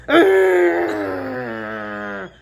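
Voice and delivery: loud, long drawn-out wheezing "ah"; strained groan. Retold or imitated. Man carrying woman uphill, imitated loud straining groan. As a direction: heave out a long effortful groan. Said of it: "Every ten seconds".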